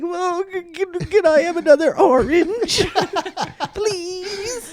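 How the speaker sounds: very high, meek stammer; timid stammering plea. Retold or imitated. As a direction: ask like a frightened polite kid. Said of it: "I'm there like".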